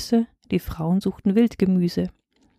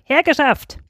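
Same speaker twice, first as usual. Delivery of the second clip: mild storybook shout; 6 semitones higher than usual. A narrator's reading. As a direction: call out the order like an impatient king. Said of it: "schrie Balak".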